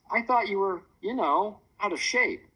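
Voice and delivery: usual speaking voice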